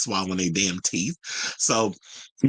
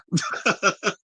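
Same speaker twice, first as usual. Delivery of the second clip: real, higher-pitched laugh; 10 semitones higher than usual. Real, not acted. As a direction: break into an amused laugh. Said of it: "That's why I'm laughing".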